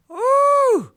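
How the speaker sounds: high, loud "ooh" squeal; delighted shriek; excited squeal. Real, not acted. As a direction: squeal with glee. Reacting to the video.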